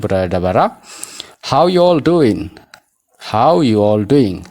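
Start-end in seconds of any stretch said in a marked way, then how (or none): 1.5-4.4 "How you all doing" slow, are left out, ending doin'; dropped are. Shown as a demonstration. Teacher showing learners the vanished R word, slow and linked. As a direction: link "How" straight to you, stretched and clear. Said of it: "Aariin sun keessaa, eh, ni badde".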